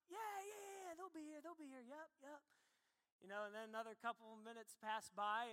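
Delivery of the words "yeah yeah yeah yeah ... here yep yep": high, hushed, nervous reassurance; a boy hiding that no one is coming; nervous kid. Retold or imitated. Kid pretending ride is coming.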